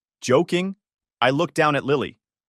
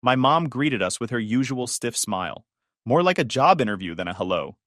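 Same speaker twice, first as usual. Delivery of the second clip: plain reading voice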